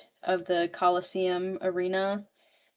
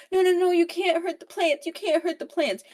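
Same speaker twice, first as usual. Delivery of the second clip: high, louder panicked plea; frantic pleading; 10 semitones higher, 4 dB louder than usual. Retold or imitated. He begs Evie not to hurt plants, panicked high plea. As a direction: beg them to spare the plants.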